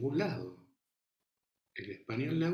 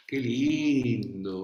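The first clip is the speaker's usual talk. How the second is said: put-on slow, stretched vowels, louder like cooing to a baby; baby talk; 8 dB louder, much slower than usual. Shown as a demonstration. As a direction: coo it to a baby, drawing out every vowel. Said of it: "estira las vocales". (habla de bebé, lenta y con vocales estiradas)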